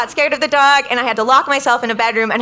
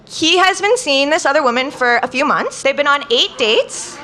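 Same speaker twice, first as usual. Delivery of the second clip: normal talking voice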